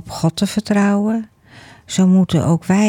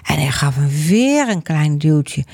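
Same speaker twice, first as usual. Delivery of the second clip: ordinary delivery, nothing marked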